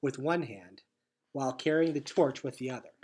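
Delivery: ordinary delivery, nothing marked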